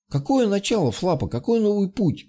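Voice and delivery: high-pitched, mildly bewildered questions; baffled exclamation. A narrator's reading. Boy questions the mage he can't understand, a lightly baffled exclamation. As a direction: exclaim in confusion, pitch well up. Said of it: "воскликнул Малкин, не понимая мага".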